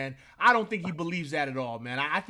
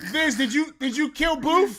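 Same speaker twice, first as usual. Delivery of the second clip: higher, louder, slowed accusing call; 6 semitones higher, 5 dB louder than usual. Real, not acted. Yelling at Vince over the kill, louder and higher. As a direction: call Vince out across the game. Calling out to Vince mid-game.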